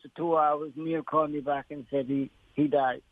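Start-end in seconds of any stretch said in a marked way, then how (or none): none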